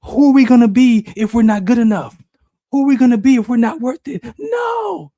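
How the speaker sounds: very high, shouted protest; panicked subconscious. Retold or imitated. Subconscious mind protesting to the speaker, high panicked shout. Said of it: "my subconscious mind says".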